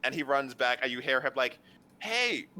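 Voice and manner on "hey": high, loud shout after someone; calling out. Acted. Game master voices a character shouting after someone, calling out. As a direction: call out high and insistent across the street.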